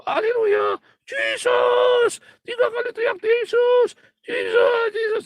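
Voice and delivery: very high, loud worship-leader yell; rallying praise shout. Acted. Preacher acting out a worship leader urging all to shout, high yell. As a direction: whip the congregation into shouting. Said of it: "Ayo sama-sama teriak".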